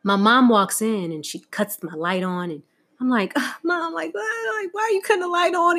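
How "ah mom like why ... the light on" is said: mock tearful whine. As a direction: whine through pretend tears at mom. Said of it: "I'm bawling in tears, crying".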